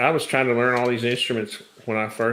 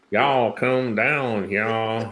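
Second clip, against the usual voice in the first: slow, drawn-out Southern drawl on Y'all come down; South Carolina drawl; much slower than usual. Retold or imitated. Mocking South Carolina politicians to a friend, slow drawl. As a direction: stretch every vowel like a South Carolina politician. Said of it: "drawn-out drawl like they do in South Carolina".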